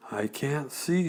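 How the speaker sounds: usual speaking voice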